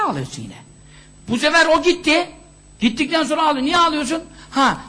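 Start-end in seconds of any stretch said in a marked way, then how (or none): none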